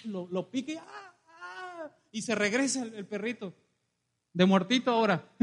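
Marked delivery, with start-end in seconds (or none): 0.8-1.9 high, quiet comic wail; mock pained cry. The stung dog wails in the preacher's fable, mock pained cry. Scorpion stings the little dog.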